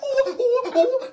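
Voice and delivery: high monkey ooh-ooh; howler monkey hoots. Retold or imitated. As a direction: hoot high like a hungry monkey. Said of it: "he's like".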